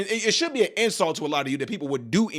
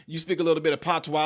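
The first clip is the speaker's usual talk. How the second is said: ordinary talk, nothing marked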